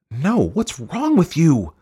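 very high, loud, drawn-out shriek; indignant high-pitched cry. A narrator's reading. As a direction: shriek at your husband in outrage. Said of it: "she shrieked".

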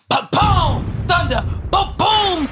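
booming mock thunder; slow shouted ba-boom thunder; thunder noises. Mimicking thunder sounds.

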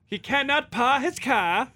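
hammed-up Boston accent, car as "caah". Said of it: "Boston accent".

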